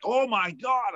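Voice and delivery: retold panic, pitched well up; a sudden gasp of fear at the news; imitating a freaked-out oh my God; freaking out. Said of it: "I'm freaking out".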